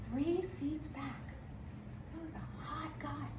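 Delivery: very soft, confiding comic aside; hushed reveal. Acted. Storyteller confiding a last temptation mid-emergency, hushed.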